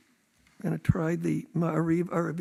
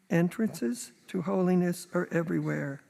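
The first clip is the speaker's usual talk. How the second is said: normal talking voice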